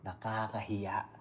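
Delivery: quiet echo. Real, not acted. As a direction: drop the voice and repeat it slowly. Echoing his own verdict. (mahina at mabagal na pag-ulit)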